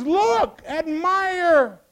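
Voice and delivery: loud, high urgent call; excited scream. Acted. John wanting his followers to see Jesus, excited cry. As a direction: call out high, demanding they look. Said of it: "He screams it".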